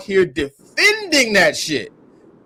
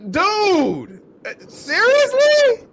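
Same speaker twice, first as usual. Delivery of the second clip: high, slow, louder seriously; disbelieving seriously; 12 semitones higher, 4 dB louder, much slower than usual. Real, not acted. Host reacting to her car excuse, slow high disbelief. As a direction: stretch it out, not believing it.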